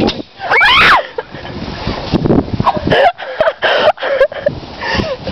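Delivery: screaming laugh. Real, not acted. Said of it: "I have never screamed like that".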